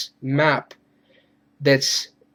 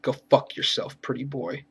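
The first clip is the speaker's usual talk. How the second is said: hushed, much softer gruff taunt; gruff Wolverine whisper; 3 semitones lower, 8 dB quieter than usual. Retold or imitated. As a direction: half-whisper it like a cool hero's put-down. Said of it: "Wolverine goes".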